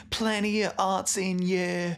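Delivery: put-on high, drawn-out Welsh-accented singing; Welsh chav accent. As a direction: sing it broadly Welsh, dropping the H in "arts". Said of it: "like, Welsh chav when I sing".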